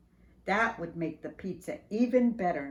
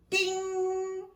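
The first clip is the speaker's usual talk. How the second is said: mock oven ding; 12 semitones higher than usual. Oven timer in story.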